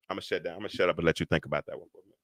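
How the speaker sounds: very quiet, low and quick; hushed, rapid throwaway to the congregation; muttered aside. Real, not acted. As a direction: back away from the point under your breath. Steps back in an aside.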